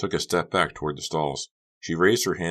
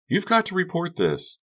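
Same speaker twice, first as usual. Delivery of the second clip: urgent friend. Acted. Friend urging.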